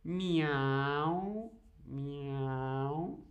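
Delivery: drawn-out mock meow; cat meow. Shown as a demonstration. Teacher meowing to show the odd one out. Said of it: "no hacen los perros en español es".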